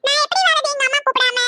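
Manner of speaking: put-on high, light child's voice; a little kid pleading with granny; young grandchild voice.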